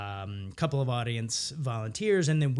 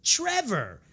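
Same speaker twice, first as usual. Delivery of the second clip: bright, high, slow in-character line; playing a grandparent's relative, chirpy and eager; improv scene voice; 10 semitones higher, slower than usual. Improviser in a scene with grandparents, high and eager. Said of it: "I was like".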